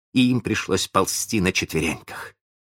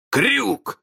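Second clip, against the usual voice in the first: крик; 14 semitones higher than usual. A narrator's reading. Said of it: "закричал он".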